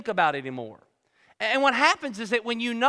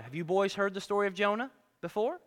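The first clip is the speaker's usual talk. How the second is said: normal talking voice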